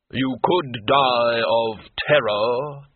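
slow, hollow intoning voice of Death. A narrator's reading. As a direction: let each word toll slowly like a graveyard bell.

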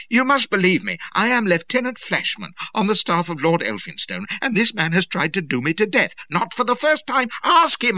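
high, loud plea building in fury; desperate shout. A narrator's reading. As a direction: shout to be believed. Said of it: "I shouted".

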